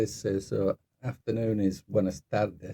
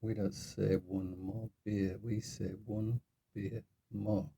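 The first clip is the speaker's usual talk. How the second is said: slow, measured mock correction; patient correction; 8 dB quieter, slower than usual. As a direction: imitate a local carefully correcting a learner. Bar local corrects his word order.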